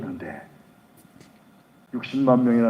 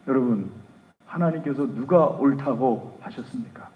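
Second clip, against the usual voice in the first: normal talking voice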